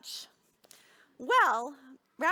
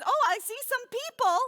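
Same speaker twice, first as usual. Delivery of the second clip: higher, louder, delighted discovery; acted story heroine, pitched up and loud; Rabbi character spotting people; 9 semitones higher, 5 dB louder than usual. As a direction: voice her spotting folk at last, bright pitch up. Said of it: "she says".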